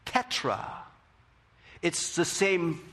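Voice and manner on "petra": whispered word. Shown as a demonstration. Pastor whispers Petra to stress the word.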